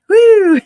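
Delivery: excited woo after a dash; breathless woo. Real, not acted. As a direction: let out a high, loud woo. Rushing back after grabbing purse.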